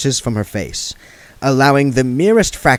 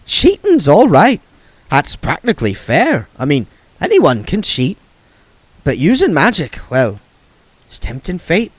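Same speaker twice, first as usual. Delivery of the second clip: put-on lightly raised, chatty old woman; a hint of gossipy old witch; Nanny Ogg's voice; 7 semitones higher, 5 dB louder than usual.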